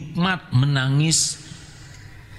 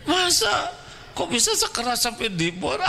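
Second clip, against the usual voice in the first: mock disbelief; 8 semitones higher than usual. Preacher mocking disbelief, laughing.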